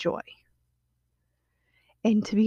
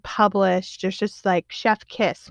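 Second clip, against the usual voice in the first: ordinary talk, nothing marked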